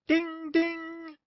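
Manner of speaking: high sung bell ding; bell imitation. Streetcar conductor rings bell.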